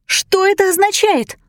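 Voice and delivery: high, sharp demanding cry; shouting. A narrator's reading. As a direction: call out into the dark. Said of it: "крикнула Джейн в темноту".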